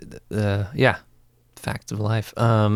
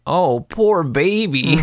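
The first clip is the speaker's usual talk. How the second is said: mock pity; 6 semitones higher, 5 dB louder, slower than usual. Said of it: "he was like".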